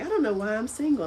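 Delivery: mocking friend. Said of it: "make fun of her".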